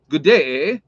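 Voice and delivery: lightly imitated Canadian catchphrase. Quoting Canadians' big catchphrase, lightly imitated Canadian. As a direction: toss out a Canadian "eh" with a questioning lift. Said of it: "that's a big Canadian thing".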